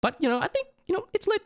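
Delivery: very high playful lit; squeaky hype. Pretend. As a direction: chirp it like a cartoon.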